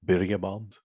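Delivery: "Birria" with a light attempt at a rolled R. Shown as a demonstration. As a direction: try rolling the R in "Birria". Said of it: "supposed to roll your R's".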